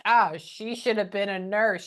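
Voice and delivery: usual speaking voice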